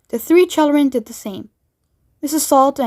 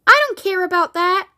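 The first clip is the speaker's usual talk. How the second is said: shouted; 7 semitones higher than usual. Acted. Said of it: "shouted Veruca".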